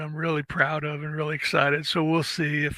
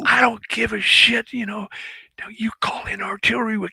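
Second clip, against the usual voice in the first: retold yelling; 6 semitones higher, 4 dB louder than usual. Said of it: "starts yelling at him".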